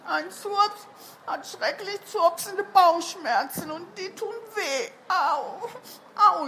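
put-on high, whining sick-child voice; wailing.

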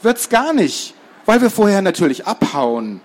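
put-on high, confident boy voice; defiant little boy. Boy dismisses friend's desert fears, defiant. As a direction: contradict it high and sure.

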